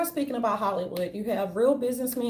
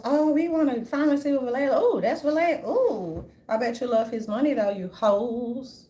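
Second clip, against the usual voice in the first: mocking gold diggers; 3 semitones higher, 5 dB louder than usual. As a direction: swoon over the rich man's money, mocked. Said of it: "And here go the Jezebels".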